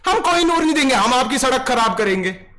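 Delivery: loud, high protest chant; mock slogan shouting. Streamer mocking protesters with a loud slogan chant. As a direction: bellow it like a street agitator.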